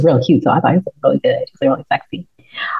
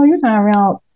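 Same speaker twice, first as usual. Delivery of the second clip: ordinary delivery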